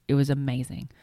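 normal talking voice

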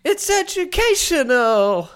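high, slow sung-style line; [name] impression. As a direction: mimic the [name] singer, stretched out. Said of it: "That's the [name], UMass".

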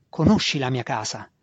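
plain reading voice

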